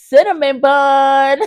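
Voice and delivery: drawn-out, slow showpiece reveal; proud patch reveal. Real, not acted. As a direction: unveil it slowly like a prize. Turns plush to show back patch.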